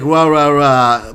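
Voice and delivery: loud mimicked ranting noise; noisy, blustering imitation; mock confrontation. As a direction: bellow it like a heated argument.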